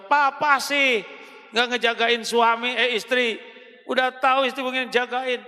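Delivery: pin the blame on him; blaming wife. Acted.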